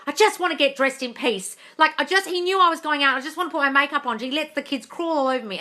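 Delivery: mock her huffy complaint; retold angry friend. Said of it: "she was really up, like, angry".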